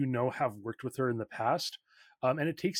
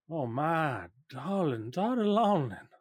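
slow, drawn-out motherly greeting; doting mother; 3 semitones higher than usual. Acted. Mother greets long-estranged daughter Lonlin, slow doting mother voice. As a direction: stretch out each endearment, acting the mother.

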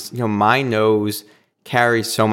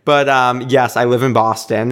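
ordinary talk, nothing marked

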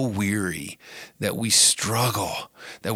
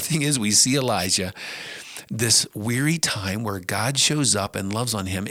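usual speaking voice

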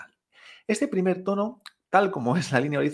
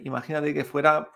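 normal talking voice

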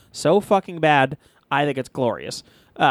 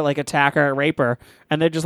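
ordinary talk, nothing marked

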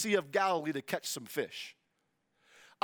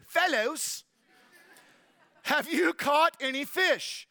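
higher, mock British call; British accent; 7 semitones higher, 4 dB louder, slower than usual. Jesus hailing the fishermen from the beach, British accent. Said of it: "I hear a British accent".

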